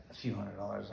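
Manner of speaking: low, very soft quoted answer; understated reply. Retold or imitated. Wife telling husband her modest day's earnings, low and hushed. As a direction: drop right down, playing it small.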